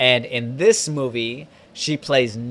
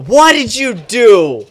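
high, slow, loud scolding; loud outrage; 8 semitones higher, 10 dB louder, slower than usual. Real, not acted. Confronting a friend over the drink, outraged shout. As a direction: draw out each word in loud disbelief.

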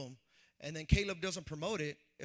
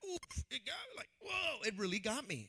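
mock crying; 5 semitones higher than usual. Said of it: "I cried a little bit".

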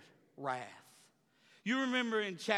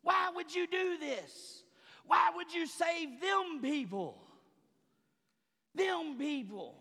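stomp and wail like a sulking prophet; preacher acting out Jonah protesting to God; Jonah's tantrum; 8 semitones higher, slower than usual. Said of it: "running around stomping his feet".

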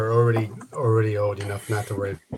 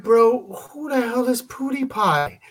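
high-pitched, drawn-out incredulous question; baffled disbelief; 10 semitones higher, slower than usual. Retold or imitated. Dad reacting to his son's YouTube idol, baffled. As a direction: act out a dad who is totally lost.

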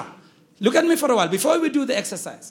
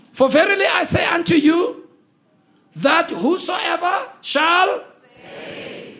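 high, slowing call for the congregation to join; raised preacher's read-along voice; leading a read-along; 4 semitones higher, slower than usual. Real, not acted. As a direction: lead the verse aloud, stretching the cue. Leading congregation in reading verse.

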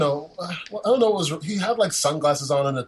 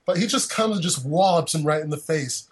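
ordinary delivery

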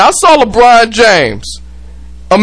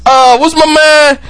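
normal talking voice

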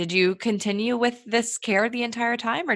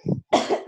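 sudden dry cough; coughing fit. Real, not acted. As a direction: cough hard once. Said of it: "Sorry, I had to cough".

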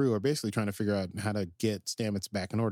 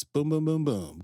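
mock credits drumbeat; 5 semitones higher, 4 dB louder than usual. Mimicking the credits music.

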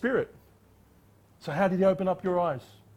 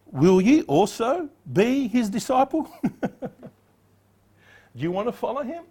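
amused sarcasm; 6 semitones higher, slower than usual. Acted. Blind man's sarcastic question.

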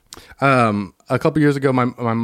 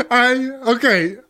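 laughing hard; can't stop laughing; 8 semitones higher than usual. Real, not acted. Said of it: "I'm rolling at you".

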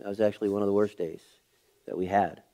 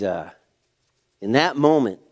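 normal talking voice

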